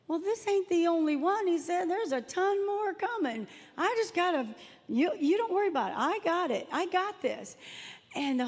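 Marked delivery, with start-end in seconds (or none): 0.1-1.5 high, put-on breezy reply; retold self-assured answer, pitched up; confident pastor. Pastor answering a worry about his big bottle, high confident retelling. 1.9-7.6 high, put-on breezy reply; retold self-assured answer, pitched up; confident pastor. Pastor answering a worry about his big bottle, high confident retelling.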